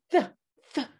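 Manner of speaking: th with the tongue against the teeth, air hissing out; th sound demo. Shown as a demonstration. Teacher models the th sound for young students. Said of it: "Use your tongue against your teeth".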